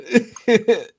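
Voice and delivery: short high-pitched laugh. Real, not acted. Friend laughs at his Brandoed joke, high laugh.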